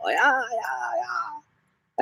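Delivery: very high squeaky yeahs trailing off; high giddy cheer; squealed yeah. Acted. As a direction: pitch way up and fade away. Hyped voice for viewer.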